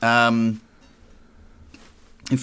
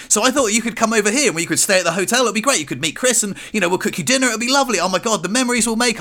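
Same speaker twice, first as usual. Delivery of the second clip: gushing excitement; 8 semitones higher, faster than usual. Retold or imitated. Blurting holiday plan to mum.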